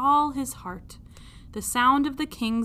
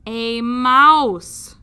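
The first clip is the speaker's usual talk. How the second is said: put-on loud, drawn-out childlike "a mouse"; correcting princess; 12 dB louder than usual. Princess correcting her father, loud and childlike. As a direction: firmly correct him. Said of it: "corrected the P".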